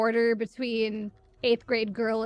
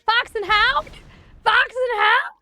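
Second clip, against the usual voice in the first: much higher and louder, in disbelief; horrified squeal of the title; incredulous shriek; 12 semitones higher, 9 dB louder, slower than usual. Real, not acted. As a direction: cry out the title like it still hurts. Outraged recalling a sad film.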